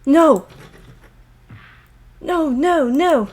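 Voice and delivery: a touch of panic; despair. Acted. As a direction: refuse to believe it. Doors fail.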